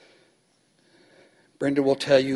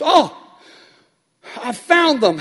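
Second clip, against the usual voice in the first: high, bright clerk voice, higher and louder; cheery clerk; 11 semitones higher, 5 dB louder, slower than usual. Retold or imitated. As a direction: play the clerk who suddenly found them.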